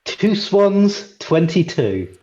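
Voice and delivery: light sing-song number call; bingo caller. Pretend. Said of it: "I feel like a bingo caller".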